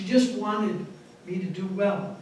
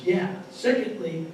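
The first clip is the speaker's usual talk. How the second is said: normal talking voice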